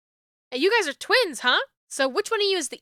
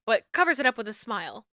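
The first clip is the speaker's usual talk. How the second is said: ordinary talk, nothing marked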